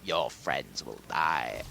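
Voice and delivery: very low, slow villain voice; Emperor Palpatine. Retold or imitated. Evil emperor threatening Rey's friends, low and slow. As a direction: draw out the threat, deep and sinister. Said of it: "Emperor Palpatine is like".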